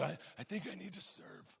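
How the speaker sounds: hushed Godfather-style mobster voice; Glenn. Acted. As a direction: imitate a tough mob guy very softly. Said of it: "he talks like this".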